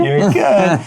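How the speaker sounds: high laughing "if you're good"; laughing parent voice. Pretend. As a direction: coo it again through a laugh. Repeats line, laughing.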